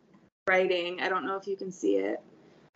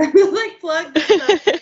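laughing; 8 semitones higher, 10 dB louder, slower than usual. Real, not acted. Laughing at her own plug.

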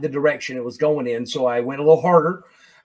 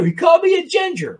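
very high imitated child voice; tattling kid. Dad imitating his kid called a ginger, high mock complaint. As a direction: play a kid telling on someone, pitched way up. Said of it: "And my kids".

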